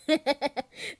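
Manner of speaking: loud high giggling laugh; helpless loud laugh; burst of laughter. Acted. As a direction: crack up at their mishap. Laughs at the other's mishap.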